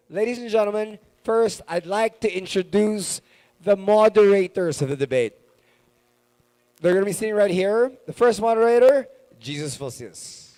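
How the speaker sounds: loud, booming host introduction; announcer voice. Acted. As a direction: call the names out to the crowd. Introducing moderators to the audience.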